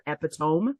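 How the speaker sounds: "epitome" as EP-i-tome; misread pronunciation. Shown as a demonstration. Phonics-taught reader sounding it out, "epitome" as EP-i-tome. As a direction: rhyme the end of "epitome" with home. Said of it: "epitome instead of epitome".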